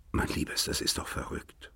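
hushed, breathless whisper; father whispering to his upset daughter; whispered plea. Acted. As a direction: whisper it, pleading. Father pleads softly with daughter.

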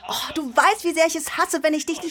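high, pleading complaint; exasperated whining. Acted. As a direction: whine it at grandma, exasperated. Teen scolding grandma for Korean.

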